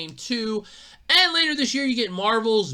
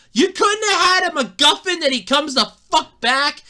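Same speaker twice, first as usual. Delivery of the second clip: heated rant; 10 semitones higher, 6 dB louder than usual. Real, not acted. Continuing angry rant.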